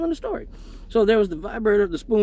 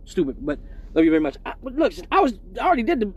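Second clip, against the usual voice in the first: ordinary talk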